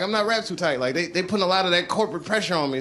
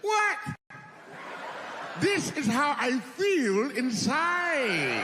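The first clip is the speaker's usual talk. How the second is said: mock innocent; 7 semitones higher, 4 dB quieter, much slower than usual. Innocent reply to critics.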